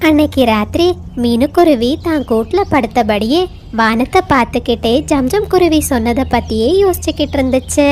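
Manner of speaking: usual speaking voice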